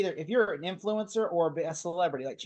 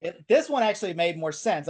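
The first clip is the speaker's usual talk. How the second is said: ordinary talk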